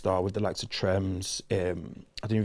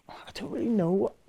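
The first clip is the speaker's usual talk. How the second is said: a high unvoiced whisper to himself; whispered aside; 10 semitones higher, 3 dB quieter than usual. Real, not acted. Whispering to himself while unsure of the difference. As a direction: breathe the words out, thinking aloud. Musing aloud on grime versus drill.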